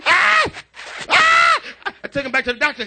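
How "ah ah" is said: very high mock infant wail; piercing imitation baby cry; imitated baby crying. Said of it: "He's crying and drinking the bottle".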